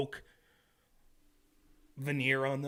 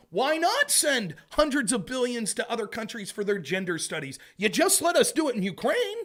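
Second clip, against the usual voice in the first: mocking government; 8 semitones higher, 5 dB louder than usual. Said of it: "next it'll be".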